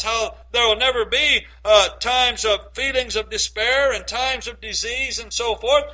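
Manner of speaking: normal talking voice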